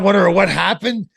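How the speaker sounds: ordinary talk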